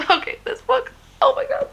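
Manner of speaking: squealing over a beloved book; excited gush. Real, not acted. Gushing over favorite book.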